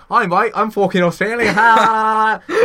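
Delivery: loud, high fake Aussie with forced laugh; mock Australian accent. Mocking comedians who profit off accents, loud Australian accent. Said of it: "Why do people laugh at that?".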